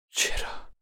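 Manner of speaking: breathy soft whispered question; quiet tender why; soft and slow. Acted. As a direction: ask gently, almost whispered. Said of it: "آهسته و با لحنی نرم پرسید".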